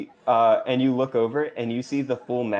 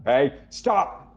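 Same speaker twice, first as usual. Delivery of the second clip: guard's shout; 4 semitones higher than usual. Acted. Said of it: "Embernoct guard".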